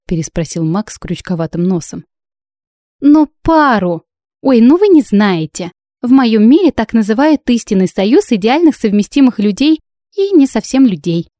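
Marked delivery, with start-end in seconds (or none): 3.0-11.3 high, louder lively explaining; airy exasperated bride. A narrator's reading. Bride explaining true pairs to baffled judges, airily exasperated. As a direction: pitch it up as if explaining the obvious.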